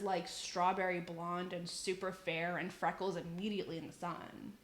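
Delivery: normal talking voice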